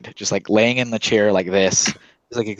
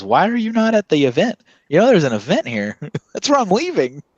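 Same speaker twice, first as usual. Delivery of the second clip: incredulous; 10 semitones higher than usual. Retold or imitated. Speaker quoting himself, incredulous.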